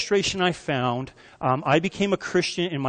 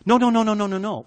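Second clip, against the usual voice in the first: mock denial; 5 semitones higher, faster than usual. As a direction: protest it in a rush, hammed up. Witness denying it loudly.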